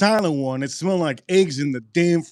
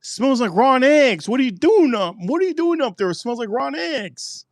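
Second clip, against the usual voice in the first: much higher, shrill-pitched mock mom call; mother yelling upstairs; 10 semitones higher than usual. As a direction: imitate mom shouting up the stairs. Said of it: "She goes".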